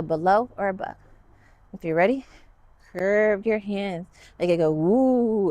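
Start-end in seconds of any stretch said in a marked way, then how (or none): 4.7-5.5 slow drawn-out playful woo. Shown as a demonstration. Teacher making airplane noises with the children. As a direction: hoot like an airplane for kids. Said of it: "Make some noises with me".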